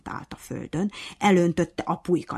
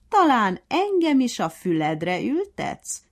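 mocking; 8 semitones higher, 6 dB louder, slower than usual. A narrator's reading. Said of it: "gúnyolódott a majom".

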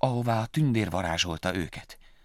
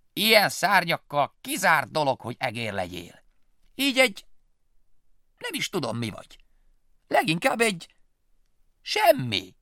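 mocking bat; 8 semitones higher, 4 dB louder than usual. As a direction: belittle the little mouse. Bat mocks Willy.

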